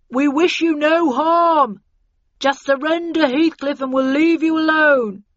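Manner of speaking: loud, slow call through a megaphone; bullhorn voice. A narrator's reading. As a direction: boom it out over a bullhorn. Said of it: "a subdued voice on a bullhorn".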